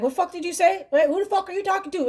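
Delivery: mock angry snap. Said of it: "you're quick to be like".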